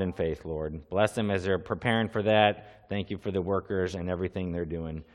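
low, soft earnest prayer voice. Real, not acted. Pastor opening in prayer to God, low and soft. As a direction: pray quietly before the church. Pastor praying before the sermon.

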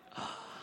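quick intake of breath, retold shock; mock gasp of alarm; startled gasp. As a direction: gasp as if braking just in time. Driver stops right in front.

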